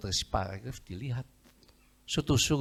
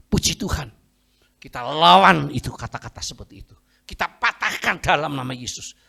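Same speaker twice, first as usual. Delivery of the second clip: loud, forceful declaration; acted child's bold rallying words; defiant faith; 4 semitones higher, 9 dB louder than usual. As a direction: proclaim it like a battle cry.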